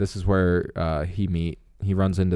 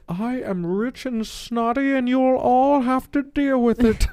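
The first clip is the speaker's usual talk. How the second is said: high, slow, snooty rich lady; pompous snotty voice; 3 dB louder than usual. Retold or imitated. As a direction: look down your nose at them. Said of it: "pompous voice".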